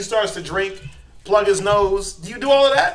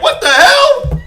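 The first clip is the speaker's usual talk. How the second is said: much higher, louder startled cry; shocked exclaim; 13 dB louder, much slower than usual. Acted. Parent watching nurse slap the baby, shocked cry. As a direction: shriek it in disbelief.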